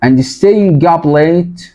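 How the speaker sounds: teacher reading the passage; reading aloud to class. Shown as a demonstration. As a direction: read it out clearly for learners. Reads text slowly.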